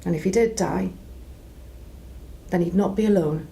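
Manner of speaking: ordinary talk, nothing marked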